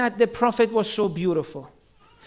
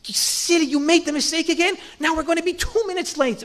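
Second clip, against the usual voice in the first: mock nagging partner; 5 semitones higher than usual. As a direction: imitate a huffy spouse snapping about being late.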